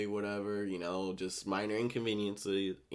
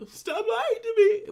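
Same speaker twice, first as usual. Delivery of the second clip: laughing squeal; 10 dB louder, slower than usual. Real, not acted. Flustered reply to a compliment.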